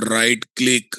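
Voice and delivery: normal talking voice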